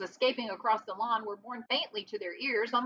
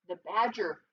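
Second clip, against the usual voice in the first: usual speaking voice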